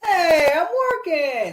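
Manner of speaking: a high, loud startled What; indignant surprise. Retold or imitated. A hero yanked away by the Watcher, protesting in surprise. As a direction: sound caught off guard and annoyed. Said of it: "It's like".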